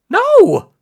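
loud, high "no"; high, loud protest; indignant denial. Acted. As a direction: shout a flat contradiction. Boy contradicts Prescar in argument.

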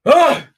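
hyped battle cry before going live; psych-up yell. Retold or imitated. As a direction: roar to pump yourself up. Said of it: "this is honestly what I did. I went".

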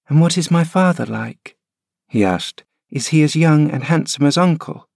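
put-on high, wistful child's question; a frail boy looking back at home; curious boy. Linton asking his nurse about his new home, high wistful boy.

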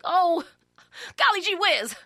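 giddy new hire in a fifties sitcom; 1950s sitcom voice. Acted. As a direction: play a naive fifties TV kid. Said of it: "I sound like a nineteen fifties Leave It to Beaver".

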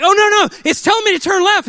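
high, loud alarmed whine; panicked driver. Retold or imitated. Husband panicking at wife over the app. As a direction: freak out at the phone's directions. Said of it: "I'm like".

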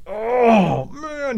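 high, drawn-out cringe; pained groan, acted. Storyteller feeling guilty as rival hurts his toe, high acted groan. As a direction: wince for the other guy's pain.

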